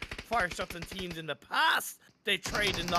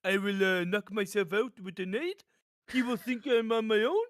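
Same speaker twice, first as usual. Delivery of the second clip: a jokey, hammed-up French accent, slightly higher; mock French accent; 4 semitones higher, 3 dB louder than usual. Caster mocking a player's self-grenade as a French genius, hammed-up French accent. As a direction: play a pro gamer in an over-the-top French accent. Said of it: "in his French accent".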